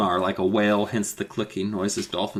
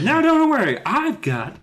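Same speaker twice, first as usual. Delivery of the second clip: high, loud character voice; Alex; 13 semitones higher, 3 dB louder than usual. Acted. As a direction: pipe up in a put-on high voice. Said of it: "And Alex is like".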